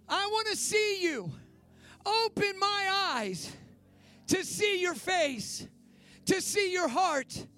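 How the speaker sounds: slow, high, loud pleading prayer; fervent led prayer. Acted. Pastor leading the church in surrender prayer, fervent and slow. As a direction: lift it up to Jesus, slow and fervent.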